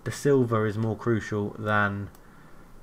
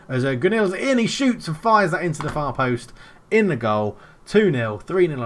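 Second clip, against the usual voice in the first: louder, higher commentary; joyful play-by-play yell; excited goal call; 5 semitones higher, 5 dB louder than usual. Real, not acted. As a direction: celebrate the goal as you call it.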